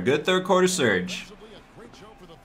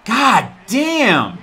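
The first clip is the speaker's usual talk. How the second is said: frustrated shout; 7 semitones higher, 5 dB louder than usual. Real, not acted. Frustrated at a play.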